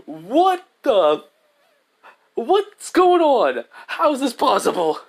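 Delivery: high, loud cry of disbelief; shocked outburst. Acted. Heroes behind the glass react to the fire, shocked. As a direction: stretch it out high and loud, aghast.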